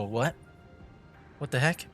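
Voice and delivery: ordinary delivery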